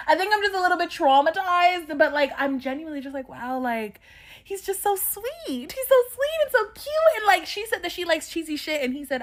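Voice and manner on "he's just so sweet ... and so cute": high swooning gush. Real, not acted. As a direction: swoon over how sweet he is. Gushing over the sweet guy.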